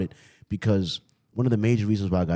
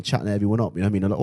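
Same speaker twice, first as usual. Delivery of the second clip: ordinary delivery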